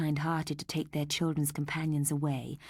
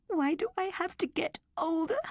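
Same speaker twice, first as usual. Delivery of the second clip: put-on high tearful girl's voice; weeping; 12 semitones higher than usual. A narrator's reading. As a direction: cry the question out like a sad child. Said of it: "she wept".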